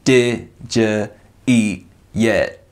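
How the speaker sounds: "Did ya" then "eat yet?" split with a pause between; each word separated. Retold or imitated. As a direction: say each word apart, slowly and clearly. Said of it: "broke up each word".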